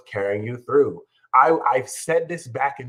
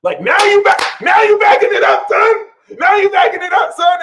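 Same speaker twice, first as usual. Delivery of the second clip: much higher, loud hype shout; hyped shout; 12 dB louder than usual. Real, not acted. Hyping his viewers up with a loud shout. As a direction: boast at full volume.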